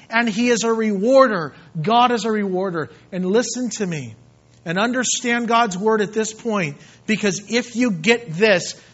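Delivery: loud, emphatic pulpit delivery; fervent preaching. Acted. Preacher urging the people that God rewards, fervent preaching. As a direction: preach it forcefully, driving the key words.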